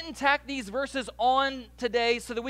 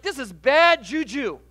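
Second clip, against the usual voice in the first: louder, slow, half-hammed alarm; mock alarmed Luther; 8 dB louder than usual. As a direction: lightly play Luther's dismay. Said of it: "was like".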